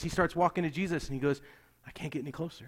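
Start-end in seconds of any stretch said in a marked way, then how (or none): 1.9-2.7 hushed retold admission. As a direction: say it quietly, as if stuck. Retold man stuck short of Jesus.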